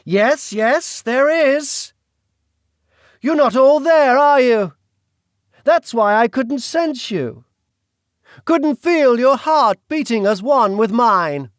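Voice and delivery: put-on high, loud, theatrical villain with a British accent; menacing aristocrat. The Duke taunting Celine, gloating British villain. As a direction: toy with her like a gloating villain. The Duke's voice held.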